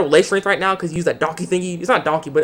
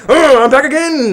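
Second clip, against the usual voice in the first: higher, louder, slow crowing; mock triumphant return; 10 semitones higher, 6 dB louder than usual. Villain returning to the fight, slow crowing voice. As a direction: crow it out. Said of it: "he's like".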